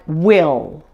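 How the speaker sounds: WILL with a drawn-out dark final L. Shown as a demonstration. Teacher giving example words, dark L ending WILL. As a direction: raise back and front of the tongue on the L of WILL. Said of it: "dark L at the end of words".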